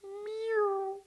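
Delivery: very high kitten mew; kitten meow. Acted. Kitten joining the ghost, high meow. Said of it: "he fetched a kitten".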